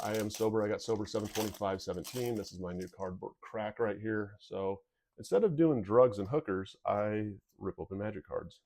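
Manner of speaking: ordinary talk, nothing marked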